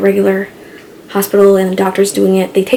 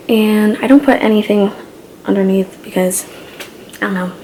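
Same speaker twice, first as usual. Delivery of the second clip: normal talking voice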